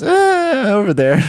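high vague "Uh, over there"; a dodgy, high-pitched shrug of an answer; evasive answer. Shown as a demonstration. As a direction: dodge the question, high and airy. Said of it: "I'd translate it as".

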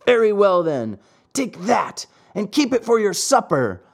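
calling out. A narrator's reading. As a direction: call it out as the first blow lands. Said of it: "called out the boldest of his tormentors".

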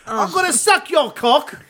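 high, slow, laughing mock British voice; mock British accent. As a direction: ham up a cheeky Brit. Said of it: "British accent".

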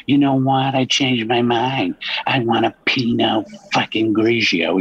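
high, prissy mock wine snob; mimicking a fussy diner changing his order. Caller mocks a picky wine drinker in a high mock voice. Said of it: "And then you're like".

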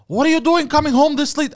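high, loud scolding mother; scolding mom. Retold or imitated. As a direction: scold him like a suspicious mom. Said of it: "I'd come home from work".

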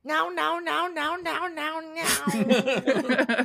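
lightly voiced toddler nagging; baby impression. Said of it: "I can do a good impression of my baby".

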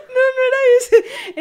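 high, squeaky, laughing through tears; can barely get the words out for laughing. Real, not acted. Wife laughs at the word mix-up, high and breaking. Both cracking up over the mix-up.

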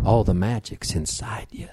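slow, whispered quote; hushed whisper. Retold or imitated. Host quoting the witch's secret about altars, slow whisper. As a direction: let it out slowly in a whisper.

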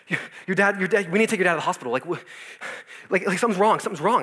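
loud, rushed, panicked retelling; a mother in a panic, loud and hurried; frantic mom. Retold or imitated. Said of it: "running around frantically".

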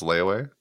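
"layaway" said LAY-uh-way, as a question; uncertain pronunciation. Shown as a demonstration. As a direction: try "layaway" with a rising, unsure end. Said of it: "I always say it in my head".

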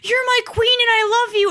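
high-pitched gushing fangirl imitation; freaked-out fan. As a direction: mimic a fan losing it over an idol. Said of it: "freaked out and was like".

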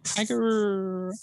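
high, slow drawn-out hi guru; mock greeting. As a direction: greet the guru with exaggerated reverence.

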